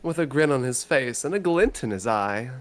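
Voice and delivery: usual speaking voice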